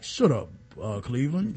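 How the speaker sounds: lightly voiced low put-down; gruff Auntie Mama. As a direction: drop the act into a low snap. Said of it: "every once in a while, she'll be like".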